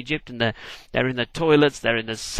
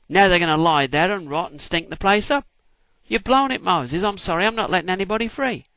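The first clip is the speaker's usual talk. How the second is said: mock scolding; 6 semitones higher, 4 dB louder than usual. Pharaoh scolds Moses over dead frogs.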